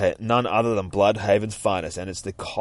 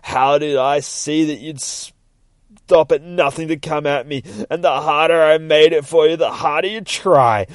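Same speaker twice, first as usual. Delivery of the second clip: gloating villain; 6 semitones higher, 6 dB louder than usual. Acted. Judge revealing his trap to Nightwing, gloating loudly. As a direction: relish each point triumphantly. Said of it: "He starts laughing".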